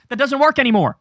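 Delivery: normal talking voice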